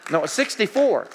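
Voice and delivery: loud, high burst of delight; thrilled loud correction; excited correction. Retold or imitated. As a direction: announce the good news loudly. Excited correction of heart rate.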